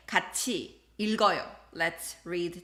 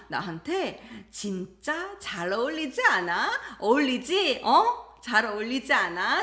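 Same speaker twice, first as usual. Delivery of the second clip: insistent coaxing; 3 semitones higher, 4 dB louder, much faster than usual. Acted. Wife pressing husband for yes.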